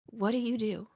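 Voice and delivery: lightly voiced curious boy; curious kid. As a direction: lean in and ask like a curious child.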